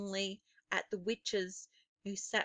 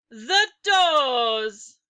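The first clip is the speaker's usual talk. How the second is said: loud, ringing witch's shout; shouted command; 3 semitones higher, 10 dB louder than usual. Acted. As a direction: bark the order to fill the room. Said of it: "she shouted".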